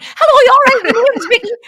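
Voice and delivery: high, loud, bubbly hello; high loud fangirl hello to a famous presenter; overeager greeting. Retold or imitated. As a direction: gush at a celebrity you're starstruck by. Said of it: "And I went".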